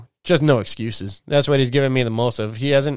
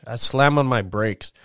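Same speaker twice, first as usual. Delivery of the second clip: ordinary talk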